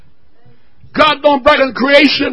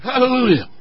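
normal talking voice

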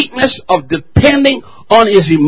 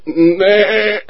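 goat's refusal. Retold or imitated. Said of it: "And the goat says".

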